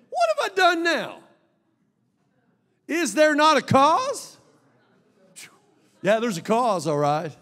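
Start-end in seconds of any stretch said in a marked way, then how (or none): 0.1-4.4 much higher and louder, slow indignant protest; indignant David. Retold or imitated. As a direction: play the wronged younger brother, pleading loud. David protests to his brother.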